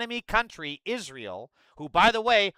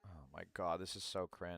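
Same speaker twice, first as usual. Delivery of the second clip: much lower, much softer disgusted mumble; low, quiet cringe; cringing groan; 11 semitones lower, 16 dB quieter than usual. Real, not acted. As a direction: sink into secondhand embarrassment. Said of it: "reacting to clip".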